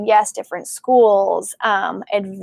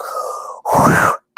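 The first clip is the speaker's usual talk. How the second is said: breathy startled gasp; sharp gasp. Retold or imitated. Medium feeling an uncle's joyful energy, gasping. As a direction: gasp as if struck by joy.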